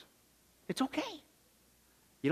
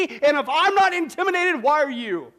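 higher, louder impassioned sermon; preaching at full force; 5 semitones higher, 4 dB louder than usual. Acted. Pastor urging the congregation not to fear, preaching hard. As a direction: rouse the church with fire.

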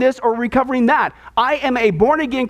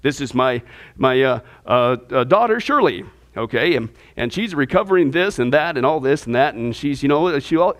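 much lower, hesitant mock introduction stumbling over labels; mock God introducing; 10 semitones lower than usual. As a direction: play a fumbling father listing her problems. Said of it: "do you think God is gonna introduce us".

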